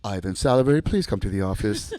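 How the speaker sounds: low, loud office summons; school announcement. Retold or imitated. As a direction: imitate the school intercom announcer.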